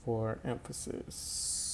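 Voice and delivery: whispered repeat. Shown as a demonstration. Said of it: "teacher repeats key phrase".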